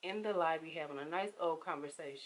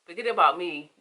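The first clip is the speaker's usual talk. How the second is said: angry; 13 dB louder than usual. Retold or imitated. Confronting friend who ignored her.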